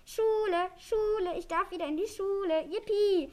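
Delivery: high, loud jubilant cheer; overjoyed. A narrator's reading. Little dragon learns school is back on, overjoyed. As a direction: cheer like a thrilled child. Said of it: "rief Nexo überglücklich".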